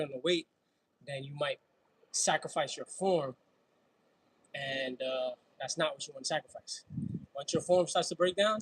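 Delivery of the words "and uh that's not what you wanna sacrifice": normal talking voice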